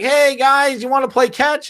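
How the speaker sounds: put-on very high, eager kid-like voice; mock Microsoft. Company asking feuding rivals to play catch, high naive voice. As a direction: pipe up high like the naive tag-along.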